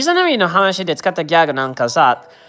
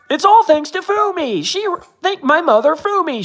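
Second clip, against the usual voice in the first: much higher, starry-eyed gratitude; possessed athlete's gushing thanks; 9 semitones higher than usual. Retold or imitated. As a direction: gush like a hypnotized fan. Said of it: "made him think that".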